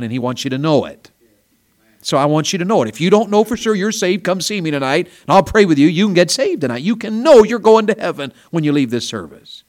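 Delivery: loud, high revival preaching; full-force altar call; fervent preaching. Acted. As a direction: plead with the crowd to come forward.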